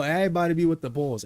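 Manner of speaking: ordinary talk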